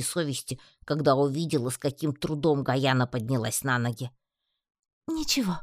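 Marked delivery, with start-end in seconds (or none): 5.1-5.6 high, weary wry reassurance. A narrator's reading. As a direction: shrug it off in a high voice. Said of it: "криво усмехнулась она".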